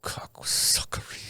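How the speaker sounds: whispered "Cocksuckery", slow and breathy; muttered whisper. Retold or imitated. Smoking, staring into nothing, whispering a curse. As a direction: breathe the word out under your breath. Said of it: "going like".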